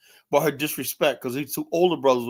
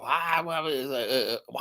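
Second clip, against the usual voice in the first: usual speaking voice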